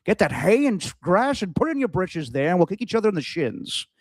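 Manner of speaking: hammed-up Scottish film-star accent; Connery. Telling a rival to pad up for shin kicking, Connery-style Scottish accent. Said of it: "I could do a Connery accent".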